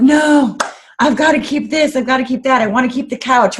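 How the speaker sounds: high, loud mock protest of her past self; clinging protest. Divorcing wife refusing to let go of things, high pleading protest. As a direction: clutch at every belonging, pleading.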